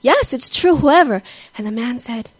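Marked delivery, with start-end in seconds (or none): 0.0-1.2 higher, louder glad answer; joyful affirmation. Retold or imitated. Albert assuring the convict, glad and louder. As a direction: burst out with the good news. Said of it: "And now Albert understood".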